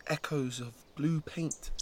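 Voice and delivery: ordinary delivery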